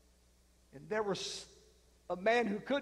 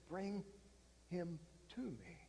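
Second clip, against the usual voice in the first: softer, gentle summons; quiet, calm command; 4 semitones lower, 15 dB quieter than usual. Retold or imitated. Jesus quietly asking for the beggar, soft and calm. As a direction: say it hushed and calm.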